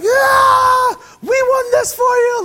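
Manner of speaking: high, loud crowd cheer; cheering fan shout. Retold or imitated. Preacher mimics fans cheering at the parade, loud shout. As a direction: yell it like a fan on the float.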